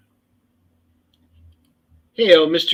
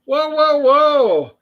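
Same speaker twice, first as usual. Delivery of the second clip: higher, louder string of objections; mock protest; 8 semitones higher, 5 dB louder, much slower than usual. Real, not acted. Co-host teased about the ugly stick, protesting. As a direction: cut in high and loud to object.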